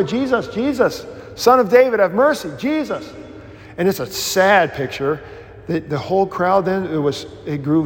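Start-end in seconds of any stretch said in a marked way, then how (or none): 0.0-3.1 high pleading cry for help; high, desperate calling from the roadside; blind man calling out. Acted. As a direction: call out over the crowd to Jesus. Blind man calls out to passing Jesus.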